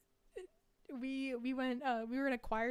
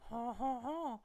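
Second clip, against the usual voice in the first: ordinary delivery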